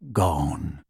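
low, flat, final house elf; grim elf voice. Acted. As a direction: drop the word heavily. Elf answers, Harry echoes.

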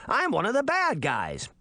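high-pitched indignant protest; exasperated cry. A narrator's reading. Puck defending his reputation, crying out indignantly. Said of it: "he cried".

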